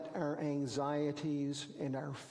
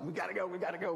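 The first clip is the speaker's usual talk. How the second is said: rushing; much faster than usual. Retold or imitated. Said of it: "So I'm trying to rush us".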